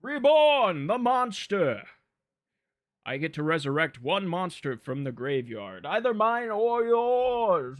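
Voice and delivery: high, loud villain announcer; mad scientist. Pretend. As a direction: proclaim it like a gleeful mad scientist. Villainous card reading, then cackle.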